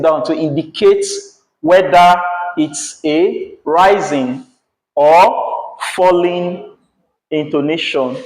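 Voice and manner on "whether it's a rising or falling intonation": very slow, stretched words; slow down to mark rising and falling; slow explaining. Shown as a demonstration. Teacher slowly spelling out rising and falling intonation. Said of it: "we use the arrow pointing up".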